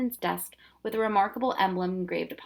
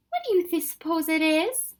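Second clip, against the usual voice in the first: awestruck; 9 semitones higher, 4 dB louder than usual. Acted. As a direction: marvel at it. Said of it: "Lindy was awestruck".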